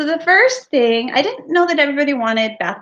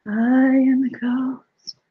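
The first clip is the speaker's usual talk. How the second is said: slow, hollow ghostly murmur; much slower than usual. Acted. Ghost's first quiet call.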